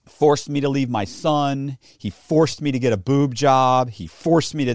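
slow, punchy stress on "forced"; mock accusations. Quoting her lawyered-up story, indignant mock accusation. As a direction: hammer out each "forced" as a charge. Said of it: "And it becomes".

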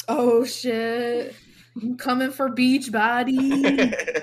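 loud, slow battle cry; hyped. Pretend. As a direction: announce it like a showdown. Teasing, announcing attack.

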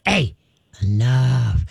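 steady, low warning to the dog; low, slow command. Shown as a demonstration. Trainer telling a barking dog enough, low and calm. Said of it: "stay low and slow".

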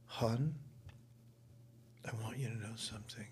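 hushed confiding whisper, breathless; soft secretive whisper to a young castmate. Retold or imitated. Older star confiding backstage.

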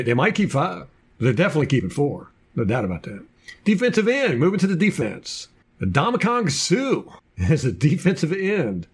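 ordinary delivery

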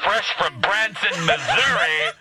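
drag show emcee. Pretend. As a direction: welcome the drag queen to the stage. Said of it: "that is my drag queen name now".

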